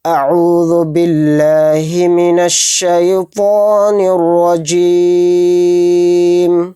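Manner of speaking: slow, drawn-out melodic recitation; Quranic recitation. Real, not acted. Teacher opens the Quran lesson, slow recitation. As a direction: chant each word slowly and reverently. Opening the lesson with ta'awwudh and basmala.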